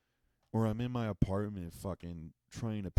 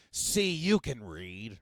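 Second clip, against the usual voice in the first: slow, louder smug mock voice; smug condescension; 2 semitones higher, 5 dB louder, much slower than usual. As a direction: drag it out, pleased with yourself. Mocking skeptic triumphant.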